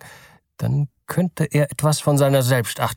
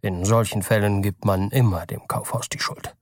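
ordinary delivery

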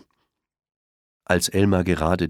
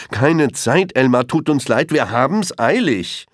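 much higher, louder rushed brush-off; hurried elephant; 9 semitones higher, 5 dB louder than usual. A narrator's reading. Elephant rushes past Elmar, high hurried brush-off. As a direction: wave him off in a hurry. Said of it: "antwortete einer der Elefanten".

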